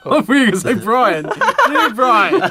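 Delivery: high laughing interjection. Real, not acted. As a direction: laugh while teasing. Laughs at the joke.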